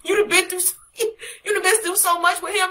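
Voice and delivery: tearful. Real, not acted. Tearful testimony memory.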